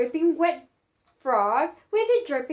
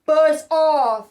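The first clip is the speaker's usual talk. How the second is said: louder rough beast voice; 3 semitones lower, 4 dB louder, slower than usual. Acted. The horrible beast telling the dog to go away, loud beast voice. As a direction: snarl it like a monster. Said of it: "it said to the dog".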